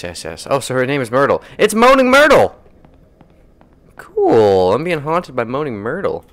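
ordinary delivery

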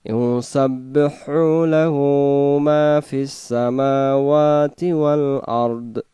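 slow melodic tajweed chant; Quran recitation. Acted. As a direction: recite slowly with long drawn vowels.

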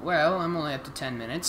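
usual speaking voice

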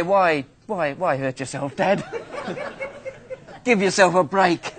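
retold child's reply broken by laughter; laughing through it. Son answering his father while laughing. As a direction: giggle through the words.

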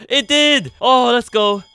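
loud, high triumphant cheer; excited celebration. Real, not acted. Player finds that the timing worked, loud excited cheer.

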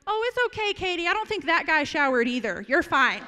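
high, loud and blurted out; really loudly. Retold or imitated. Brother reassures his sister across the table, loud and high. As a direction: say it for the whole restaurant to hear. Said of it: "says really loudly".

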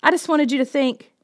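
high, loud pleading; boy shouting his defence; loud protest. A narrator's reading. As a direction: keep the scream going in protest.

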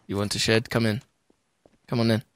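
low, soft creepy invite. Pretend. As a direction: lure them in quietly.

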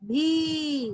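भी with a long, sustained ee; long vowel. Shown as a demonstration. Teacher answering what भा became, long-held भी. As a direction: stretch out the vowel of भी. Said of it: "भा में बड़ी की मात्रा जोड़े थे".

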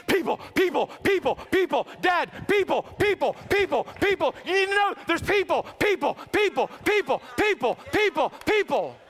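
high, loud barked words; barking dog voice. Retold or imitated. Preacher translates his dog alerting Dad, high frantic barking speech. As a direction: yap the words out like an excited dog. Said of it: "What he's trying to say is".

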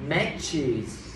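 MATCHES slow and clear with ch, no tr; correct ch. Shown as a demonstration. Teacher corrects the student's slip, MATCHES with clean ch. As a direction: say MATCHES in two syllables, clean ch then iz. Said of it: "chỉ, chứ không được có tree".

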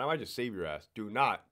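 ordinary talk, nothing marked

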